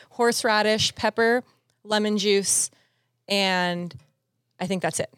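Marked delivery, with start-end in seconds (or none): none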